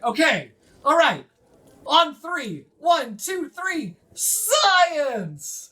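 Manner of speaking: high, loud rallying chant; high loud team chant; gnome team cheer. Acted. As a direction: count them in and shout the cheer.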